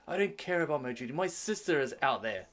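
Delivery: loud, high, impassioned protest; defiant prince. Acted. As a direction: argue back as the desperate brother. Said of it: "he's like".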